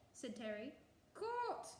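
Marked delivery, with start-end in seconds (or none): 1.1-1.7 a far higher, incredulous yelp; outraged disbelief. A narrator's reading. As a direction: spit the word back in disbelief. Boggart outraged at court threat.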